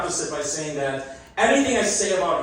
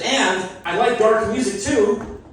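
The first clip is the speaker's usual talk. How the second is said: high, slow mock Russian accent; bad Russian accent; 6 semitones higher, slower than usual. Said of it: "worst Russian accent".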